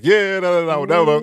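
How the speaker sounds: high taunting voice, jeering; mocking opponents. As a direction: taunt like a cocky winner. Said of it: "they start banging on our door like".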